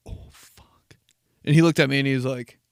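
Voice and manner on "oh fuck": breathy unvoiced whisper of panic. Kid catching his own slip, whispered panic. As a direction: whisper the curse under your breath.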